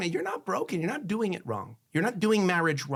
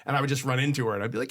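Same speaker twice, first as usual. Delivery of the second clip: normal talking voice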